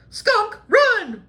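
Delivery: high, loud alarm; panicked yell. Acted. Friend yelling to the others to run from a skunk, high and loud. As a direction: shout a warning to flee.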